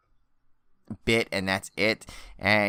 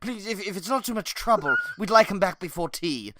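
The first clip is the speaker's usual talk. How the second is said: sophisticated gentleman; 10 semitones higher than usual. Pretend. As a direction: beg politely like a refined English gent. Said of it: "some really sophisticated guy".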